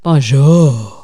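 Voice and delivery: Bonjour brought down low. Shown as a demonstration. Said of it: "There you go".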